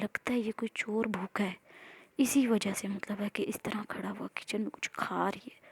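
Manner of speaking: hushed unvoiced whisper, creeping suspense. A narrator's reading. Narrator whispering as the girl creeps toward the thief. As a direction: whisper it like sneaking up on someone.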